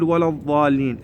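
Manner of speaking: الضالين with the tongue pushed forward; wrong example of ولا الضالين; mistaken dad. Shown as a demonstration. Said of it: "نطلع لساننا لي قدام".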